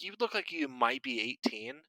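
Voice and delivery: ordinary delivery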